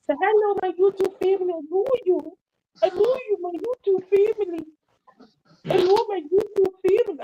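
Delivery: high, sweet put-on greeting voice; high sing-song greeting. Vlogger coos a greeting to her YouTube family, high and sweet. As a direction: coo it up high like a cartoon host.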